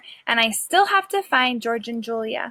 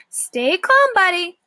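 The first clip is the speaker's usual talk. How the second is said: higher, louder coaxing man's voice; soothing catcher; 7 semitones higher, 5 dB louder than usual. Acted. The shelter man coaxes the stray dog down, higher and louder. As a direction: talk a scared dog down from a rock. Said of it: "eases me gently but firmly".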